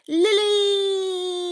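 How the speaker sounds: high, loud call of a name. Real, not acted. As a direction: call out a happy greeting. Calling out to a viewer.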